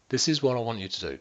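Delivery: usual speaking voice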